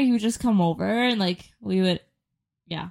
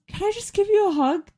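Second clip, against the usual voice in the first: mimicking the boss; 11 semitones higher than usual. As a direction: voice the boss gently, pitched up. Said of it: "He goes".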